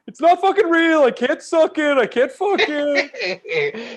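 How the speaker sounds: high, loud mock outburst; hammed-up furious friend; mock angry rant. Imitating Dan ranting about the fake photo, high mock fury. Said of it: "'Cause he's like".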